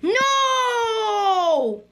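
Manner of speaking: high, loud, anguished cry. Real, not acted. Kid losing right after boasting, loud cry. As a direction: cry out in defeat.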